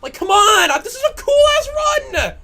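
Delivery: yelling at the screen in real rage; furious shout. Real, not acted. Streamer screaming at his ruined run, furious shout.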